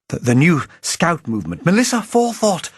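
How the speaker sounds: stammering start rising to a loud high shout. A narrator's reading. Old agent telling Playfair the truth, shouting. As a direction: blurt the name out at the top of the voice. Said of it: "I shouted".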